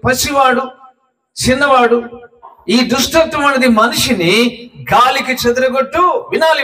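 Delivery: high-pitched, driving sermon delivery; impassioned preaching. Acted. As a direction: preach it urgently, pitched well up. (ఉద్వేగంగా, హెచ్చు స్వరంలో ప్రసంగం)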